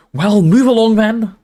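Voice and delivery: high, loud, drawn-out order; Professor McGonagall, sharp. A narrator's reading. Professor to the bedraggled crowd, sharply. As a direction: herd them along briskly but slowly worded. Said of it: "said Professor McGonagall sharply".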